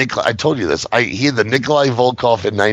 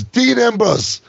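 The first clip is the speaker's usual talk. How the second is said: little nephew echoing the name, much higher; imitating a toddler; 11 semitones higher, slower than usual. Uncle imitating his little nephew repeating the wrestler's name. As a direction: pitch it up like a three-year-old repeating. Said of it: "And he goes".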